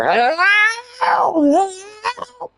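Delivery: high desperate cry for help from far off; imitated distant scream. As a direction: call out like someone screaming across a field. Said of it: "I hear something screaming in the distance".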